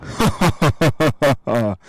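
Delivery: big jolly giant's laugh, full and loud; hearty booming laugh. Acted. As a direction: laugh like a jovial giant filling the room. Ghost's booming entrance laugh.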